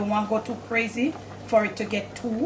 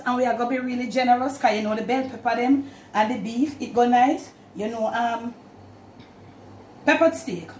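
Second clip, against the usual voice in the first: normal talking voice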